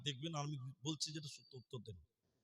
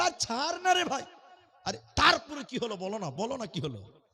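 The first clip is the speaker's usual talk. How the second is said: retold insistent pestering; 7 semitones higher, 11 dB louder than usual. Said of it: "ই বলবে না, ও ছাড়বে না".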